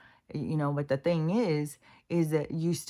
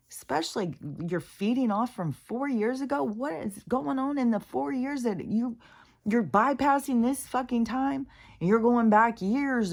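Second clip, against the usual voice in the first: pitched much higher, outraged disbelief; exasperated rant; 9 semitones higher, 3 dB louder than usual. Real, not acted. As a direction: rant like you can't believe what you're seeing. Exasperated rant about cheering crowds.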